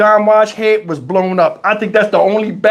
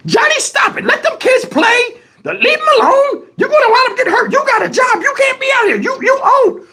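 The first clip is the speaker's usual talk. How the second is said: put-on very high scolding old lady; grandma voice.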